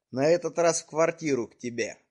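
usual speaking voice

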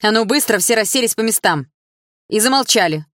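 teacher barking at the class. A narrator's reading. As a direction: snap the class into silence. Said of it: "рявкнула Атом".

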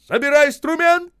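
high, loud command; urgent shout. A narrator's reading. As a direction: bark it to the whole crew. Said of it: "крикнул Осип".

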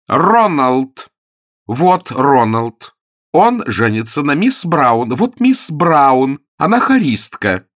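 slow, loud, word by word; slow emphatic explaining. A narrator's reading. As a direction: spell it out to a dullard. Spelling it out to dim earl.

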